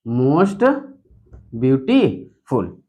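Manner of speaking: Most beautiful slow, pausing after most and before फुल; separated parts. Shown as a demonstration. Teacher sounding out the superlative for students, slow and separated. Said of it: "तो beauty अलग".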